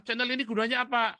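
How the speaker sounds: mock questioner. Questioner asking what the channel is for, mocking imitation. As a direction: put on a pushy asker, pitched high.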